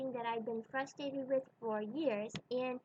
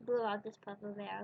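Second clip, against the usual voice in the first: ordinary delivery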